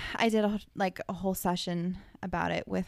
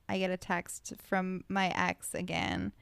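ordinary talk, nothing marked

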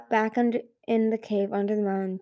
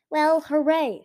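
sarcastic; 6 semitones higher, 4 dB louder, slower than usual. Acted. Sarcastic reply.

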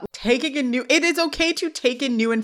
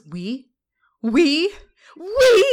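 building squeal; 5 semitones higher, 3 dB louder, much slower than usual. Retold or imitated. Sean squealing at Corey in disbelief, rising to a loud squeal. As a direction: climb each "We?" higher and louder.

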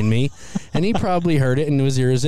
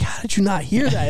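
surprised; 9 semitones higher than usual. Retold or imitated. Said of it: "I'm like".

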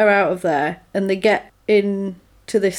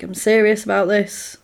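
ordinary talk

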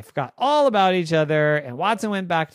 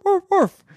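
high, loud playful woofing; high-pitched mock dog barks; 8 dB louder than usual. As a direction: yap like a storybook dog. Voicing a dog in a story.

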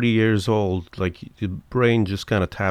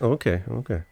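ordinary delivery

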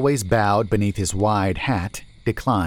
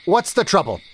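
raised, higher voice, hurrying them along; impatient call; 10 semitones higher, 4 dB louder than usual. A narrator's reading. Merchant chiding his driver about lost daylight, raised. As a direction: push them, daylight is going.

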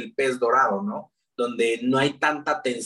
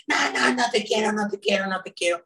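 put-on high child's voice refusing, fading; angry little girl; 7 semitones higher than usual. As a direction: pout like an upset small daughter.

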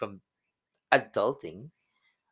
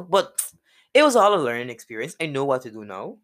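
ordinary delivery

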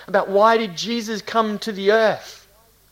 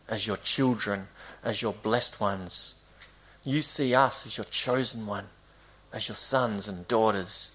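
softer, lower prayer voice; 5 semitones lower, 4 dB quieter than usual. Real, not acted. Pastor thanking God with the congregation, prayerful. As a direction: pray gently in measured phrases.

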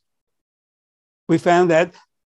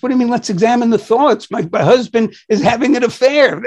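higher, louder, fast indignant protest; indignant patient; 7 semitones higher, 4 dB louder than usual. Retold or imitated. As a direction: retell the patient's outraged disbelief.

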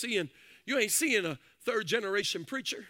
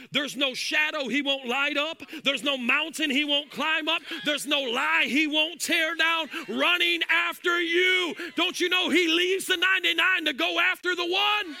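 rising, shouted preaching over music; full-force preaching; 4 semitones higher, 4 dB louder than usual. Acted. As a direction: preach it hard and loud, building. Builds to shouted climax.

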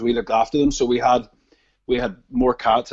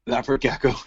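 Northern Irish accent. Shown as a demonstration. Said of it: "can you just say leopard gecko again".

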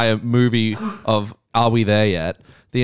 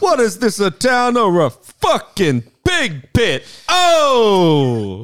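sneering dad; 9 semitones higher, 4 dB louder, much slower than usual. Retold or imitated. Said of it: "He's like".

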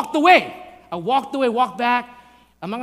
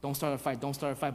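soft, low self-warning; hushed acted inner voice; inner pleading; 6 semitones lower, 10 dB quieter than usual. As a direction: quietly talk yourself down. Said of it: "In my head, I'm like".

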